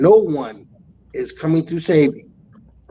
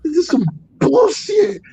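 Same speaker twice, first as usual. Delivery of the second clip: fed-up disbelief; 11 semitones higher than usual. Retold or imitated. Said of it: "I'm just like".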